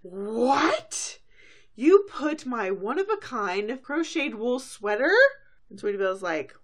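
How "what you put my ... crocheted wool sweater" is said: loud, high outraged disbelief; shocked double take. Acted. Rarity spotting her sweater on the clothesline, loud shocked outburst. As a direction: shriek the question in mock horror. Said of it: "does a double take".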